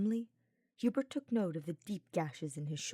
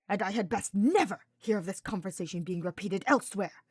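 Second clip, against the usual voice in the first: spat warning; 7 dB louder than usual. Acted. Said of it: "Hubert spat".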